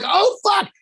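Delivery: sudden high dismayed curse; realizing he missed it all. Retold or imitated. Scene: fan in casino realizing he missed bets, dismayed curse. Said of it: "And I'm like".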